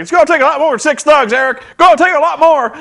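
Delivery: high, loud mock shout; indignant wrestler. Jeff Jarrett defying Eric Bischoff, high hammed-up shout. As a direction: ham up an outraged veteran's protest. Jarrett repeating his line.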